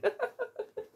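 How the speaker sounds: high, giggly laugh; short burst of high laughter. Real, not acted. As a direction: laugh off a wave of emotion. Laughing at her own joke.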